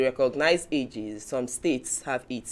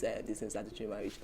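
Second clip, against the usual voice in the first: ordinary delivery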